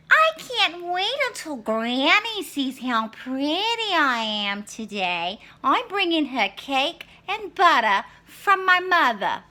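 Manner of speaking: put-on high, loud vain girl voice; Little Red. Little Red boasting to the wolf, high vain voice. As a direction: brag like a pretty little girl.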